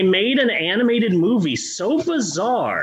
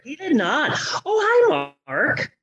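Tommy Wiseau impression; 7 semitones higher than usual. Acting The Room scene.

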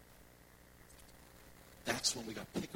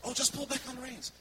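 much higher, louder casual call; friend calling out; 13 semitones higher, 5 dB louder than usual. Retold or imitated. Friend shouting advice to the scared rider, high and loud. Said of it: "He goes".

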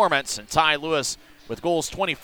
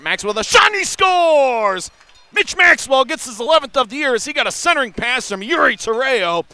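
goal call shout; 8 semitones higher, 4 dB louder than usual. Real, not acted. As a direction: belt out the goal. Goal call in hockey broadcast.

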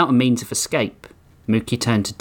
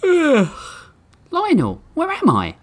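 drowsy, higher, waking from long sleep; just woken up; much slower than usual. Acted. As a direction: sound freshly roused from sleep. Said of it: "voice that was if she was just waking up".

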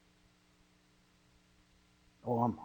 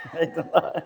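laughing. Real, not acted.

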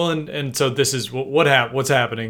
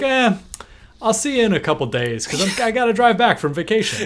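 higher-pitched, offhand mimicked reply; casual brush-off; 9 semitones higher than usual. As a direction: shrug off the summons like it can wait. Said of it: "the casualness that he treats the request".

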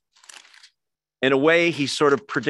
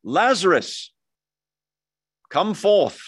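commanding summons, pitched up; raised, stately command; calling out; 5 semitones higher, 4 dB louder, slower than usual. Retold or imitated. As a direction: call out grandly as if to the dead. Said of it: "He calls out to a dead man".

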